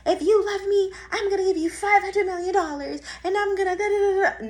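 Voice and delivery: high, loud mock deal-making; mock conditional bargain. Mocking love with conditions attached, high loud put-on voice.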